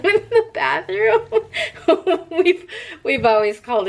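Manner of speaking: laughing through the words; giggling while explaining. Real, not acted. Laughing about the fart fan name.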